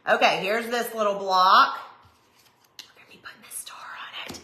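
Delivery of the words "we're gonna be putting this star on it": whisper. Real, not acted. Whispered craft aside.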